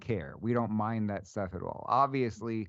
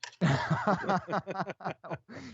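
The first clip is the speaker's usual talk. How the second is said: laugh; 7 semitones higher than usual. Real, not acted. Host laughs at one-horse joke.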